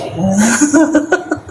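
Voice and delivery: rising high-pitched giggle; teasing laugh at the guard. Real, not acted. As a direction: let a high laugh climb.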